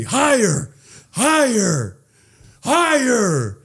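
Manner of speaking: high, drawn-out egging-on; mock cheering. Family urging him to throw the groom, mock cheering.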